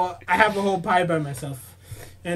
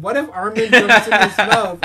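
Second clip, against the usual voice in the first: loud, high excited quote; hammed-up eager suggestion; mock excited; 4 semitones higher, 10 dB louder than usual. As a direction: blurt it out like a wild idea. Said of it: "Sean friggin' suggested".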